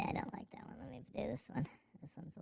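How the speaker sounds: unvoiced whisper aside. Real, not acted. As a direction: drop to a whisper.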